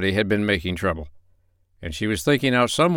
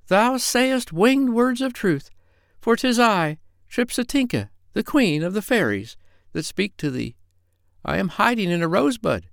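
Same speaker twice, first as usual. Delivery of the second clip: tiny tinkling fairy voice; 9 semitones higher than usual. Pretend. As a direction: play a grand fairy queen. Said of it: "small, high voice like a little girl".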